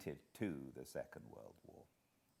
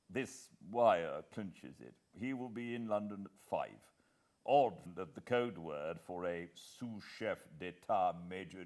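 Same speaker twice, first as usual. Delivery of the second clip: lightly voiced brisk English gentleman, a touch louder; a hint of a senior official briefing, firm and louder; Sir Walter; 2 semitones higher, 7 dB louder, slower than usual. Sir Walter briefing Hannay over breakfast, lightly voiced and firm.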